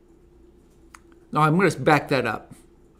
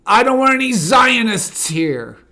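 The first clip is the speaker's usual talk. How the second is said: raised, much louder mock shout; imitated yell; 9 semitones higher, 10 dB louder than usual. Host retelling a man yelling on the subway, loud imitated shout. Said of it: "where a guy yells".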